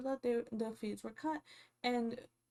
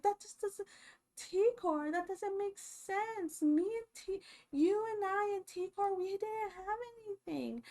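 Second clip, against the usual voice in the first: much higher, whiny mimicry of an upset housemate; mock upset woman; 10 semitones higher than usual. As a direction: squeak it out like a mocked, flustered woman. Voicing Angela's upset protest.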